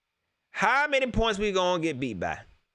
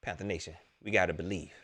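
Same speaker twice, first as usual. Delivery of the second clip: hushed rally; 11 semitones lower, 7 dB quieter, faster than usual. Real, not acted. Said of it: "hushed rallying".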